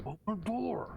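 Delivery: high, strained hushed shout; whisper yelling. Acted. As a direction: yell under your breath. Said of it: "whisper yelling".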